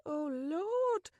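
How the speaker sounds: flustered cry. Acted. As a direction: panic peaking on Lord. Panic peaks.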